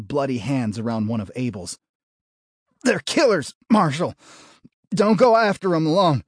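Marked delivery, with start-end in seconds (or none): 2.8-6.2 high, loud, strained gasps; wounded man forcing words out; pained gasping. A narrator's reading. Dying deputy pleads.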